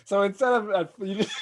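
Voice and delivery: ordinary talk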